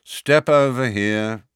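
slow, loud, bored guard. A narrator's reading. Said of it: "said the wizard in a bored voice".

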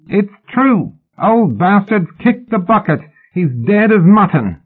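high, gleeful holler; shrill, giddy boy's shout; boy's yell. A narrator's reading. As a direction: crow the news from the wall. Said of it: "the boy yelled".

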